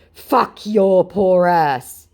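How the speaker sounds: very slow, high, loud mock scammer; slow put-on scammer curse. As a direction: drag out the insult as the scammer. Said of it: "he said".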